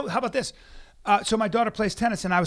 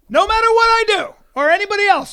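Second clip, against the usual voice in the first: exasperated shout; 12 semitones higher, 8 dB louder than usual. Real, not acted. Exclaiming about the giant athlete.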